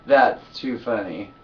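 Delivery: slow, loud and laughing; savoring the laugh; amused. Real, not acted. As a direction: still chuckling at the joke.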